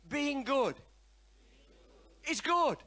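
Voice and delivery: preacher leading the crowd in a loud refrain; leading the congregation in a chant; call to repeat. Shown as a demonstration. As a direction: belt out being good is good for them to echo. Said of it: "Everybody say".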